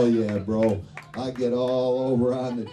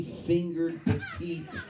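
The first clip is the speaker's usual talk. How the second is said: ordinary talk, nothing marked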